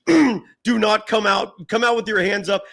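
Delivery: slow mock megaphone announcement; imitated police loudspeaker. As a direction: mimic police ordering someone out. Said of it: "there's microphones yelling".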